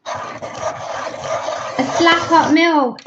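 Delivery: high, very slow drawn-out "A slap up meal"; slang phrase showcased. Shown as a demonstration. To stream viewers, introducing London slang, savoured slowly. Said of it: "a slap up meal means a really nice meal".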